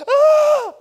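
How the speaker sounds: shrieking, very high and loud panicked yelp; imitated passenger scream. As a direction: scream like a terrified passenger on a sharp turn. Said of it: "and they're like".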